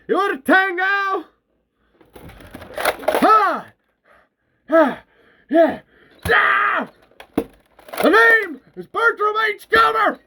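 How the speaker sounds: high, wild battle taunting; taunting shout. Acted. Bert luring creatures after him, taunting shout. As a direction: dare the enemy with big yells.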